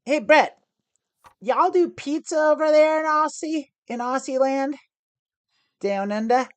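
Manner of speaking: a hammed-up Aussie twang, teasing a friend; fake Australian accent. Streamer teasing Aussie chat friend Brett, mock Australian accent. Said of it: "when I do my fake Australian accent".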